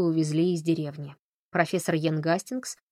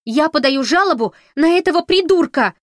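loud, high, outraged screech; furious yell; 10 semitones higher, 10 dB louder than usual. Acted. Audrey screams her complaint at the sheriff. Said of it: "завопила она".

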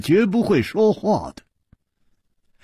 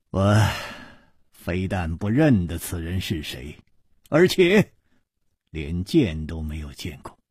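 sighing; 10 semitones lower, 3 dB quieter than usual. Acted. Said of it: "叹道".

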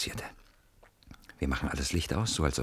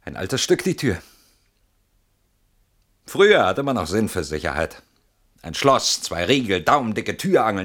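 ordinary delivery

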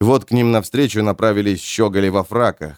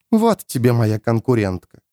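ordinary delivery, nothing marked

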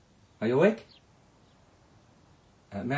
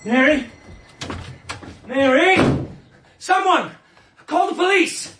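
panicked shouting. Acted. Finding the body, calling for help in a high panicked shout. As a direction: shout the house awake in panic.

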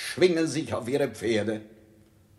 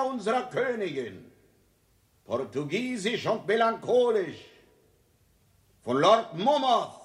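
royal proclamation; 9 semitones higher than usual. Acted. King formally proclaiming his retreat to Salisbury. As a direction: proclaim it like a herald.

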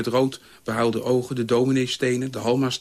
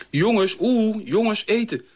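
high, carrying mother's call; 9 semitones higher, slower than usual. A narrator's reading. Mother calls boys from below the stairs, high calling out. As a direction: call the children to dinner in a high voice. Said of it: "riep mijn moeder onder de trap".

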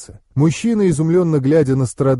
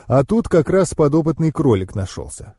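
ordinary delivery

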